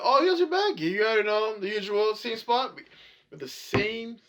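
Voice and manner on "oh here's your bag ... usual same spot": high, bright mock-polite waitress voice; sing-song fake customer service; imitating the staff. Friend mimics the Denny's staff greeting, mock-polite. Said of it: "they were like".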